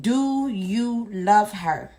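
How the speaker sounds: drawn out, each word weighed; a slow deliberate ultimatum; slow pointed question. Retold or imitated. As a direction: ask it slowly, daring him to answer. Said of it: "And so I said".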